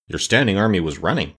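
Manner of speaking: ordinary delivery, nothing marked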